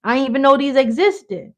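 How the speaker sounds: mock outraged kid; indignant kid. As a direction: complain to mom in disbelief. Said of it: "I'm like".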